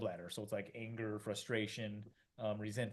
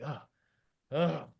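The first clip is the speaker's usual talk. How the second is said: loud, weary mock groans; hammed-up fed-up groaning; exasperated groans; 3 semitones higher, 6 dB louder than usual. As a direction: groan out a long tiring day. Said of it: "you can be gasping".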